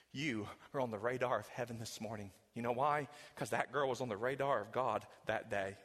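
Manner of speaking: low, hushed pastoral voice, drawing the listener close; quiet tender sermon passage; soft intimate preaching. Acted. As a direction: lean in and speak tenderly to the lost.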